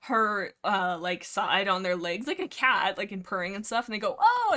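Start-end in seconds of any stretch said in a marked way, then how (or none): 4.2-4.5 high, loud cooing "oh"; delighted surprise. Acted. Kids react to a purring cat, mock delighted surprise. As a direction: mimic kids suddenly charmed.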